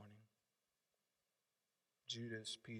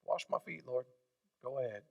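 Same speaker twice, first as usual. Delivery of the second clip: softer, offhand shrug of a line; couldn't care less; Judas indifferent; 10 dB quieter than usual. Acted. Judas leaning back as Jesus washes his feet, offhand and soft. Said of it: "a difference in an attitude".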